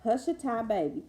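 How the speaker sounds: lilting read-aloud for little listeners; gentle storytime voice; storybook reading. Acted. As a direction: read it like a bedtime picture book.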